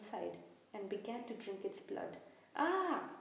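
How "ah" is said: sudden high, loud scream of terror; terrible scream. A narrator's reading. Malfoy sees the figure drink blood, screaming. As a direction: shriek as you bolt in fright. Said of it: "Malfoy let out a terrible scream".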